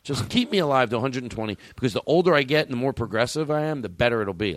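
usual speaking voice

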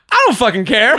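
laughing retort. Retold or imitated. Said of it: "Godfrey was just like".

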